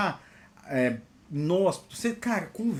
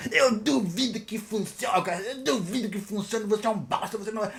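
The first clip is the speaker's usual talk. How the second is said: mocking doubter; 3 semitones higher than usual. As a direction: imitate a scornful colleague, stretching it out. Colleague insulting him, put-on voice.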